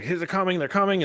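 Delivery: high-pitched retold alarm; urgent warning. Character warning the Pryor of attackers, mock urgent alarm. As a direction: imitate a character sounding the alarm.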